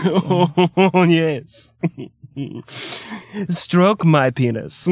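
slow, prim, hammed-up moan; mock English lover. Mocking English lovers, slow prim hammed-up moan. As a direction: play the prudish Brit getting racy. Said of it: "prim English lover".

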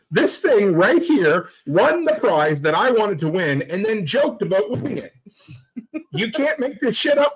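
high-pitched, incredulous complaint; mock outrage. Real, not acted. Griping that his wife won his prize, mock outrage. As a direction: play up the exasperation, ending in a laugh.

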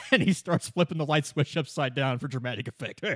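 ordinary talk, nothing marked

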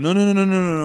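quick high insistent no's; eager no, no, no. Retold or imitated. Fiancée's quoted eager refusal.

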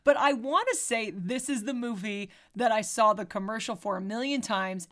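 ordinary talk, nothing marked

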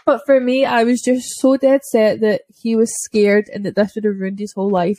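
normal talking voice

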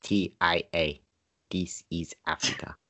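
low quiet put-on African accent; DiCaprio-style African accent. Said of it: "my DiCaprio African accent".

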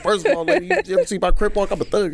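high-pitched mock tough-guy bluster; indignant protest. Man retelling his protest to his therapist, indignant and high-pitched.

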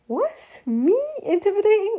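shocked squeak at the idea; incredulous. Retold or imitated. Told by a friend she seems intimidating, incredulous.

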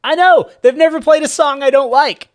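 put-on high excited child voice. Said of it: "said another".

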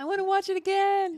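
high, loud eager exclamation; excited imitation. Co-host mimicking the eager request to rewatch, excited.

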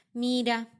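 ordinary delivery, nothing marked

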